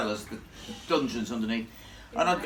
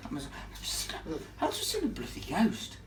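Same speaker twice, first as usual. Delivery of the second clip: shaken whisper; 6 dB quieter than usual. Retold or imitated. Stunned, reporting a ghost on the phone.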